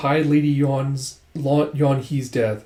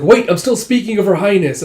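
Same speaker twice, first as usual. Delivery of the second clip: high, loud objection; acted protest; 7 semitones higher, 7 dB louder than usual. Dragon protests as the king walks away, loud objection. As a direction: demand to be heard out.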